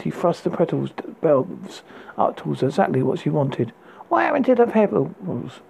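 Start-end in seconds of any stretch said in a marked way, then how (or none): 4.1-5.6 teasing. A narrator's reading. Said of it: "she teased".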